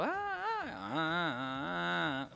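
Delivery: high-pitched imitation of an alarm crying; mock alarm wailing. Comedian mimicking alarms crying at a wake, high mock wail. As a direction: wail like a weeping alarm, half joking. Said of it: "Están las alarmas llorando".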